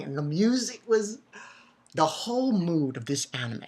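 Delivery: ordinary talk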